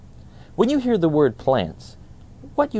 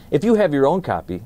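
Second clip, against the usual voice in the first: ordinary delivery, nothing marked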